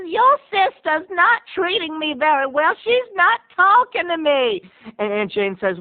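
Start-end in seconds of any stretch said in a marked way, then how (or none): 0.0-4.6 grandma complaining to her grandson, high and pitiful; whiny grandma. Retold or imitated. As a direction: plead for sympathy like a sad grandmother. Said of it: "she says".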